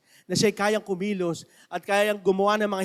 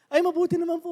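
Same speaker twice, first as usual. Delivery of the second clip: cheerful Mamberto; 10 semitones higher, 3 dB louder, faster than usual. Retold or imitated. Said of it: "Sabi niya".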